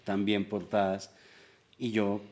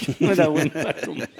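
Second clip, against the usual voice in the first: laughing through the words, higher and louder; 7 semitones higher, 6 dB louder, faster than usual. Real, not acted. Laughing while admitting he drank badly. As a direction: chuckle as you admit it. Laughing about his drinking.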